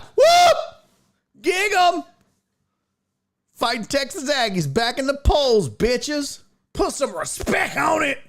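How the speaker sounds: high, whooping celebration; triumphant fan cheer. Real, not acted. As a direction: crow it loud like a fan back on top. Celebrates own team back in polls.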